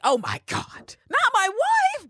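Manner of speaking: high, loud, stretched wail; mock shocked cry. Maria seeing the news, mock wail, high and loud. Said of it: "She definitely was like".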